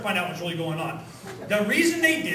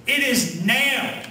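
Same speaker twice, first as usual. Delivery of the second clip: ordinary talk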